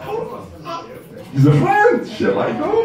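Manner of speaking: mock naive tourist; naive self. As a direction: sound gullible and flattered. Naive inner thought.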